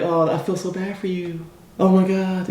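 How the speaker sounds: high, soft, cooing commiseration; mock sympathy. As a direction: coo with exaggerated pity. Acts friends giving sympathy.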